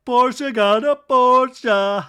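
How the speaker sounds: high, loud, drawn-out gleeful announcement; mock glee. Mo announcing the gift, slow loud hammed-up glee. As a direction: stretch every word like big news.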